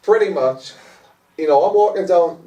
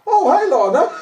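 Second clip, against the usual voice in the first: surprised laughing hello; 12 semitones higher, 4 dB louder than usual. Retold or imitated. Surprised greeting on meeting Lorna.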